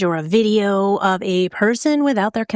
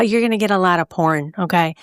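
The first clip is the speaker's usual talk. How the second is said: ordinary delivery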